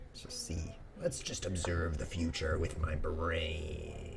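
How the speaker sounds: slow, low mock-mystical voice. Streamer mock-predicting the chess future slowly. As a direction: ponder it like a fake fortune teller.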